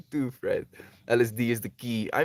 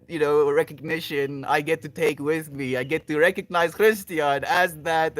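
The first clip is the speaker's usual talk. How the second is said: ordinary delivery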